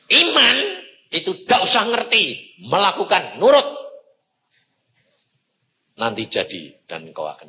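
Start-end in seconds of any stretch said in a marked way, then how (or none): 0.1-3.7 high, loud preaching burst; forceful preaching. Real, not acted. Preacher urging the congregation, high and loud. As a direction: hammer home that faith means obeying. Preacher drives point home.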